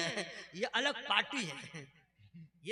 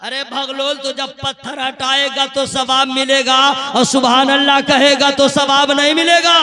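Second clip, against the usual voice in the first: building louder to a scolding punchline; rising scold; 2 semitones higher, 18 dB louder than usual. Acted. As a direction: drive the point home at the crowd. Scolding crowd, rising volume. (डांटते हुए ऊँची आवाज़)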